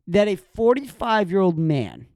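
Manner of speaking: usual speaking voice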